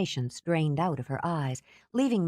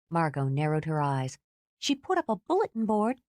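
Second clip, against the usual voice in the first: usual speaking voice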